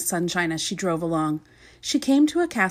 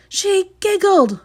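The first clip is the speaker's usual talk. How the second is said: high, slow blubbering; blubbering king; 12 semitones higher, 5 dB louder, slower than usual. Acted. Bursting into tears over her giggle, high slow blubber. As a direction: blubber through mock tears. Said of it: "blubbered the king".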